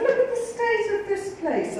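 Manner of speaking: high-pitched retold indignation; appalled complaint. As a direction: protest like an affronted hotel guest. Appalled by the squalid cell.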